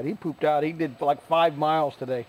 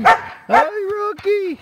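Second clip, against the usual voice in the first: very high, slow, louder drawn-out hello; 6 dB louder, slower than usual. Real, not acted. Owner greeting dog Rocky, stretched squeaky hello.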